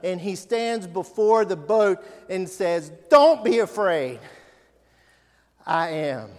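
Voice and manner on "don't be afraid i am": strong, loud command not to fear; firm loud reassurance. Acted. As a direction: call it out over the storm. Preacher voicing Jesus loudly.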